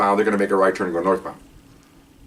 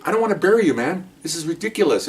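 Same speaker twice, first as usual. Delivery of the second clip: high exasperated plea, easing off at the end; retold yelling; 8 semitones higher than usual. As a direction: act out fed-up scolding, pitch raised. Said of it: "And I'm yelling".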